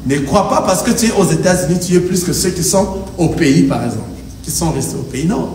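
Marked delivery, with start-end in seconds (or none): none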